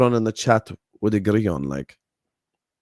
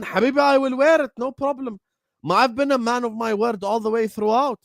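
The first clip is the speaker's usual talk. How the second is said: indignant pleading; 7 semitones higher than usual. Real, not acted. Defending himself loudly to chat.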